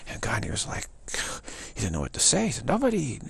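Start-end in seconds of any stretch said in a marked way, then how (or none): 2.7-3.2 high, loud commanding cry; stern outburst. Retold or imitated. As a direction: slam it down like an offended master. Gandhi refuses to be defied.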